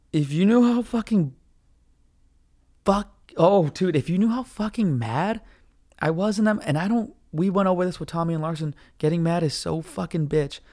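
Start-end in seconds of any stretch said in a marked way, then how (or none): none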